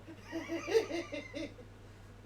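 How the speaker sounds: very high, squealing giggle; high-pitched laugh. Real, not acted. Teasing a friend in the room, high squealing laugh.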